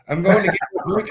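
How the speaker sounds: usual speaking voice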